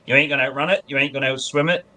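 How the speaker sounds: ordinary talk, nothing marked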